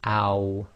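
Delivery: "ow" with lips rounded into a small circle; Arabic aw with sukun. Shown as a demonstration. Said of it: "small circle with the lips".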